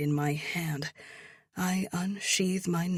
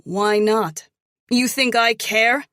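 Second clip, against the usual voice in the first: loud scornful comeback; scornful retort; 6 semitones higher, 11 dB louder than usual. A narrator's reading. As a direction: fire it back with contempt. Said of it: "I shoot back".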